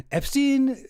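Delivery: high-pitched mock puzzlement at the name; feigned ignorance. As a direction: act like you've never heard the name. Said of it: "guy who's pretending he's never met Epstein before".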